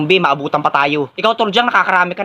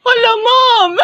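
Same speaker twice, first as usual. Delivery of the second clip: very high theatrical wail; hammed-up crying; 3 dB louder, much slower than usual. Student told to bring parents, hammed-up crying. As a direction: burst into exaggerated bawling.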